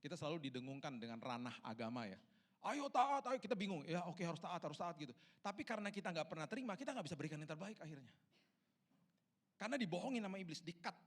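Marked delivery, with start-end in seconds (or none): 2.6-3.4 high, loud badgering command; acted insistent yell; mock shouted exhortation. Acts out religious demand to obey. 3.4-11.0 raised, urgent sermon voice; fervent preacher in full flow; impassioned preaching. Real, not acted.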